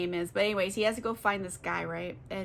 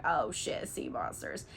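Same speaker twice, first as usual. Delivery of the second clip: hushed dread; 9 semitones lower, 8 dB quieter than usual. Retold or imitated. Crew sees monsters.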